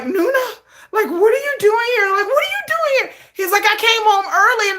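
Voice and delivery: high, loud flustered questioning; shocked whine. Retold or imitated. Jin home early finding Nuna there, high flustered whine. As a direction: whine in high-pitched disbelief.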